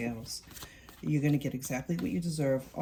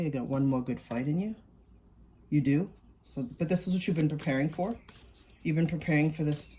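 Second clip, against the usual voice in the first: ordinary talk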